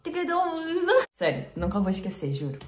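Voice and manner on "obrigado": high, slow heartfelt thanks; grateful tourist. Retold or imitated. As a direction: say it slowly and high, deeply grateful. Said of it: "falaram assim".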